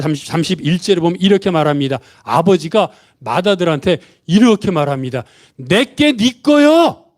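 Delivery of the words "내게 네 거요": 내게 네 거요, each word slow and high; slow emphatic phrase. Shown as a demonstration. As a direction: spell out "내게 네 거요" slowly for the congregation to repeat. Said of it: "따라해 보십시오". (천천히 또박또박 강조해서)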